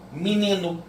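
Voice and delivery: "Menino" said as "minino"; "Menino" blended back as "minino"; spoken minino. Shown as a demonstration. Teacher showing the class how "Menino" is spoken. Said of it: "É assim que nós falamos".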